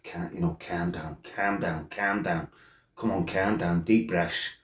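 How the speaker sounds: hushed, breathy mutter to himself, calm down; whispered self-calming. Retold or imitated. Fuming in the pub, quietly muttering calm down to himself. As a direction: talk yourself down under your breath.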